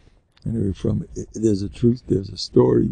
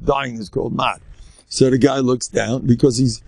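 ordinary delivery

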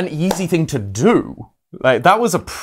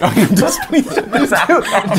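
laughing mock; 8 semitones higher than usual. Echoing the mock voice.

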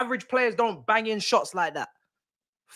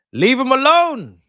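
defiant shout; 7 semitones higher, 8 dB louder, slower than usual. Real, not acted. Defending his midfielders to critics.

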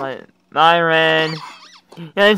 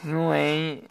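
ordinary delivery

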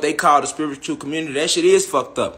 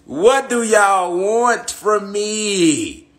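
exasperated plea; 4 semitones higher, much slower than usual. Retold or imitated.

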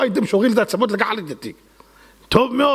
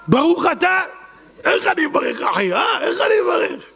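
mock boast; 10 semitones higher than usual. Mocks a proud blesser.

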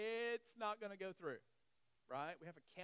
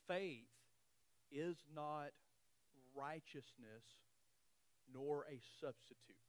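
read slowly; 6 semitones lower, 4 dB quieter, slower than usual. Shown as a demonstration. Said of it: "read it slowly".

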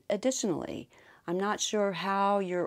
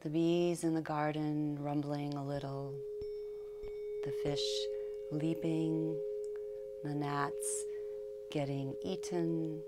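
calm verse, fading softer towards the end; meditative poem reading; 3 semitones higher, 7 dB quieter than usual. Acted. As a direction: read the poem softly, letting each phrase rest.

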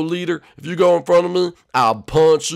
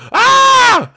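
very high, loud yelp; high scream; 9 dB louder than usual. Acted. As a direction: scream as if suddenly hit. Acted scream when hit.

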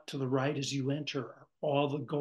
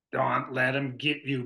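burred warning; 6 dB louder than usual. Acted. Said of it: "burred the bearded dottore".

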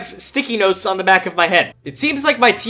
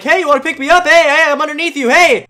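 mocking teammate; 8 semitones higher, 6 dB louder than usual. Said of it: "my teammate's just like".

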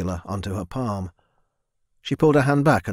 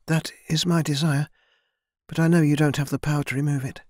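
put-on higher, timid young woman's voice; young servant girl; 5 semitones higher than usual. A narrator's reading. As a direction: voice a shy girl speaking up to her lord. Narrator voices Mina.

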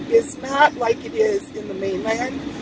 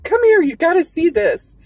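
higher-pitched, urgent summons to come and look; imitated gawker; 6 semitones higher, 4 dB louder than usual. Woman calling her husband over to stare, mock amazement. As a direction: mimic someone calling a partner over to gawk. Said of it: "grabbed her husband. She said".

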